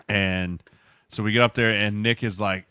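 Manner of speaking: normal talking voice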